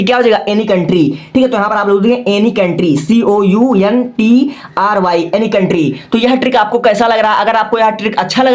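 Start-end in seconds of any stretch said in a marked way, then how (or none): 3.0-5.3 slow, separated letter names; spelling out. Shown as a demonstration. Teacher spelling country for students, slow letter by letter. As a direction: space out each letter as you spell.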